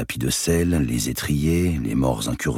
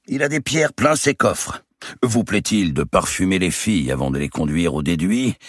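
booming giant; 7 semitones higher than usual. Acted. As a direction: play a swaggering giant of a nobleman. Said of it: "dit le géant".

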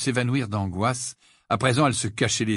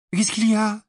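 high, anxious question; higher-pitched worried voice; 11 semitones higher, 4 dB louder than usual. A narrator's reading. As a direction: ask with sudden concern. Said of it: "s'inquiéta Hermione".

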